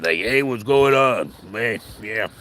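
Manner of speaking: slow, laid-back stoner drawl; lazy greeting from the back room; imitating Ed. Manager Ed greeting from the back, slow laid-back drawl. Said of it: "Just like".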